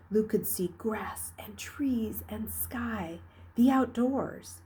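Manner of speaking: plain reading voice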